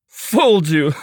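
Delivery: gleeful teasing tone; teasing laugh. Acted. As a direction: tease him with a grin. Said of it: "he laughed".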